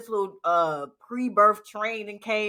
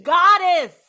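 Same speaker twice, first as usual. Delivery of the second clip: exclaimed word; 9 semitones higher, 11 dB louder than usual. Real, not acted. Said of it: "That's the word".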